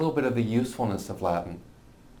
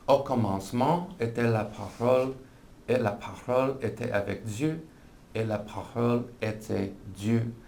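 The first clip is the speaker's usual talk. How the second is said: amateur French accent reading scripture; horrible French accent. Shown as a demonstration. Teacher reads John one one in French, horrible French accent. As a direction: read it in French with a learner's accent. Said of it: "will have heard my horrible accent".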